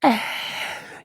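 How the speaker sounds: high burst of imitated royal laughter; king's big laugh. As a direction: let out a huge belly laugh as the king. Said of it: "the king laughs so hard".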